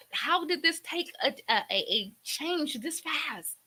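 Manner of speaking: mild retold outrage, pitched high; angry questioning. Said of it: "You're angry at your faith walk".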